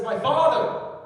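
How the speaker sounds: "My Father" called out high and loud; exclaimed. Shown as a demonstration. Preacher cries out Jesus's prayer to his father. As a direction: cry out "My Father" like a child to his dad. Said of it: "he starts out and he says".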